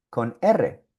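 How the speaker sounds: normal talking voice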